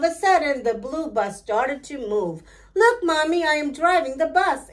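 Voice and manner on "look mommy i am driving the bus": put-on higher, bright child's cry of delight; excited exclaim. A narrator's reading. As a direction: call out thrilled to mommy. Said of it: "exclaimed Bambolina".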